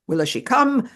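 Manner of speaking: tearful and angry. Retold or imitated. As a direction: plead it, hitting come. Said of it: "Tearfully, but also angrily".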